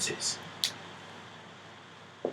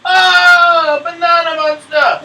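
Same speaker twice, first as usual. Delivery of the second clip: high, loud, drawn-out shriek; acted alarmed scream; 12 semitones higher, 12 dB louder, much slower than usual. Teacher acts the pony seeing the monster, shrieking. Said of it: "She goes like this".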